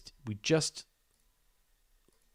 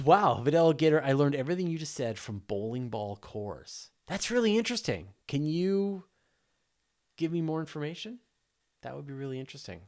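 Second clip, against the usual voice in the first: mock amazement; 5 semitones higher, 5 dB louder than usual. Mock-amazed reply to chat.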